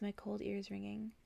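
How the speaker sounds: usual speaking voice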